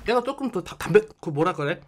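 chewing while talking; talking between bites; mouth full. Real, not acted. Seen 1: Bites biscuit at -2.0, chews while talking, then licks ice cream.

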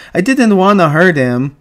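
put-on distressed childlike protest; crying out. A narrator's reading. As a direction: plead miserably like a sorry child. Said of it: "Lenny cried".

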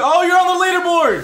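high, loud burst of delight; excited cry. Real, not acted. Friend spotting the player on the leaderboard, excited high cry. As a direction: yelp it out in surprise.

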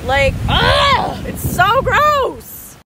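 drawn-out, high, loud disgust; disgusted groan. Real, not acted. Filmer sickened by the beach creep, real disgust.

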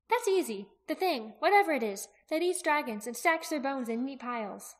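put-on high, animated girl's voice; confident young Aki. Aki answering Jaqen about the dragon eater, high confident voice. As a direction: answer readily, pitched well up, settling later.

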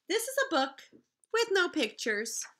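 bright, lilting read-aloud voice; storybook reading. Acted. Teacher reading the book's opening to her class, lilting.

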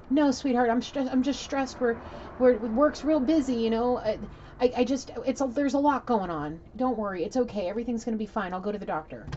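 high, loud, stammered mock excuses; defensive placating. Man excusing himself to his sweetheart, acted-out flustered placating. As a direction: imitate a flustered man, easing off as he reassures.

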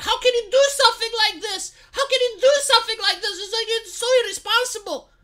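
high, hammed-up shrill outrage, voice pushed way up; mocking outraged leftist. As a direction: parody a scandalised leftist, pitched high. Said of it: "when you tell leftists, like".

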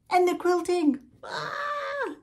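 high, loud squeal of delight; excited squeal. Acted. Host teasing viewers about the crafts, squealing high. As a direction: shriek the last craft with glee.

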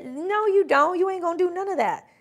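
sassy retort. Real, not acted. Telling the fakers off, sassy and quick. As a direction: shut them down with sass. Scolding fake business people.

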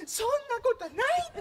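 泣きながら慰める女の子をふざけて真似て; mock tearful girl. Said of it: "女の子が泣きながら".

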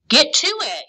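high, loud command; yelled order. A narrator's reading. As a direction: yell them into action. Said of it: "he yelled".

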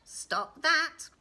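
high, loud little-man order; sharp command. Acted. Little man interrupts the sobbing girl, sharply. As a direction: cut in loudly, hitting the last word.

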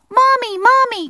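very high, loud mouse call; high-pitched happy shout; joyful calling. Acted. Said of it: "called Little Mouse joyfully".

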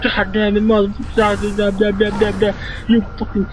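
high-pitched mock drill-sergeant rant; yelling army sergeant. Army sergeant yelling at the troops, mock rant. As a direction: imitate a sergeant chewing out the troops. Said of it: "army sergeants, they just yell at the troops".

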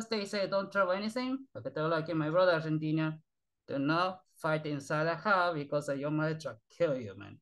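normal talking voice

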